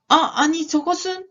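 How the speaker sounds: high, startled stammer; amazed discovery. Acted. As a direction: gasp it out in storybook amazement. Story figure spots something amazing.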